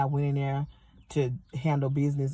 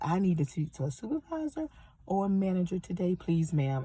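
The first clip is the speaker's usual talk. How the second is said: higher, polite customer voice; deliberately non-aggressive; 3 semitones higher, 4 dB quieter than usual. Retold or imitated. Customer asking cashier for a manager, carefully non-aggressive. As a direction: put on a careful, unthreatening request voice. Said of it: "that's how I said it in that voice. So I didn't wanna sound aggressive".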